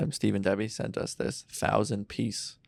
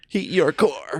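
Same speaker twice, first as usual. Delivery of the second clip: energy drink ad; 6 semitones higher, slower than usual. Pretend. Mock energy drink slogan.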